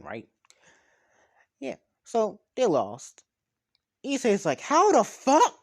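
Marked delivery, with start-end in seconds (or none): 4.6-5.6 high, loud, incredulous yell; yelled outburst. Retold or imitated. Issei reacting to the lost game, yelling. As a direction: yell it out in disbelief. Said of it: "he just yelled this out".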